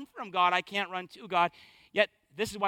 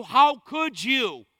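slow, loud scolding "How could you?"; mock scolding; 3 semitones higher, 7 dB louder, much slower than usual. Preacher voicing a scolding God. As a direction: drag it out like a disappointed scold. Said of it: "He doesn't say to you".

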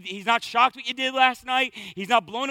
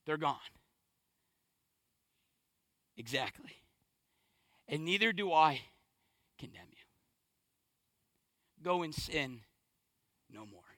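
şefkatli İsa; 7 semitones lower, 10 dB quieter than usual. Acted. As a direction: quote it softly, letting each phrase fade toward a whisper. Voicing Jesus to the accused woman.

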